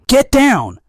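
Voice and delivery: loud, high, urgent roar. A narrator's reading. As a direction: roar the warning with everything. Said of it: "Damduk roars".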